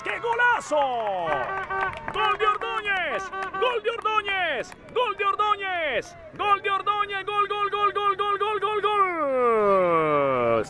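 long soaring goal shout; breakneck goal commentary. Real, not acted. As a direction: scream the goal, stretched out. Goal scored, commentator erupts.

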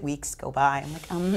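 ordinary talk, nothing marked

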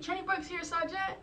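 high, crisp mock recruit reply; recruit answering. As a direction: answer the roll call like a new recruit. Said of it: "She's gonna be like".

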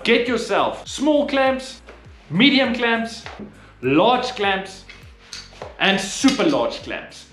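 ordinary talk

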